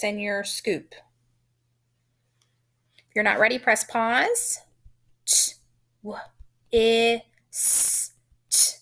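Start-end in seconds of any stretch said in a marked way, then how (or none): 5.3-8.8 T, w, I, s, t as separate letter sounds. Shown as a demonstration. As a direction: sound out T, w, short I, s, t with gaps. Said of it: "It's a short I".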